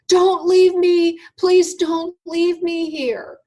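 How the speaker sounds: high, loud, slow desperate plea; desperate begging scream. Retold or imitated. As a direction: scream it out, begging them not to go. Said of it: "I screamed out almost as if begging".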